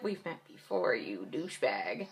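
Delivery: low, soft insult under the breath; muttered retort. Retold or imitated. Patient to doctor who forgot meeting her, muttered retort. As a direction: drop it low and quiet, like a private aside.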